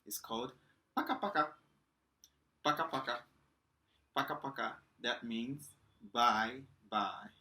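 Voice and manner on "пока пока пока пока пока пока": sing-song пока-пока; Russian slang pronunciation. Shown as a demonstration. Teacher teaching the slang пока-пока to students, playful pron. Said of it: "I'm gonna teach you the slang".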